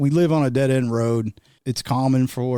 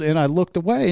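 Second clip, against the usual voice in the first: normal talking voice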